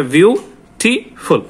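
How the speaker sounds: Beautiful drawn out as বিউ... টি... ফুল; careful syllable-by-syllable demo of beautiful; slow, syllable by syllable. Shown as a demonstration. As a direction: say beautiful very slowly, pausing between syllables. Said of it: "আস্তে আস্তে করে বলতে হবে".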